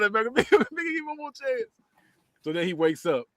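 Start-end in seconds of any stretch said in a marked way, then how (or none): 0.0-1.6 very high put-on plea; mock pleading. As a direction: squeal the plea, imitating, without shouting. Repeats the plea amid laughter.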